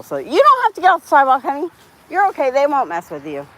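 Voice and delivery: soothing a scared dog, sweet high voice; reassuring the dog. Real, not acted. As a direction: coo to a nervous dog to calm it.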